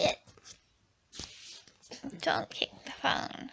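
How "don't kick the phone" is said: soft whispered warning. Real, not acted. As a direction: whisper a gentle telling-off. Mum telling baby off softly.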